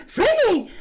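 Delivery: high mock call of a name; sudden high quoted exclamation; imitated breaking in. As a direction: burst in with a high-pitched cry. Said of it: "then she, he breaks in".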